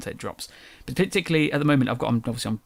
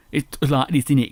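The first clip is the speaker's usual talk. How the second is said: broad English accent, a touch louder; casual dirty English accent, a bit louder; 3 semitones higher, 7 dB louder than usual. Shown as a demonstration. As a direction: lay on the dropped-T English accent. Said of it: "I have a dirty English one".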